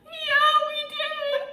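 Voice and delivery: high, slow, sobbing-laughing reply; retelling the cat goodbye, slow sobbing voice; mock sob. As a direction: whimper it like you're still crying. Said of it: "We're sobbing".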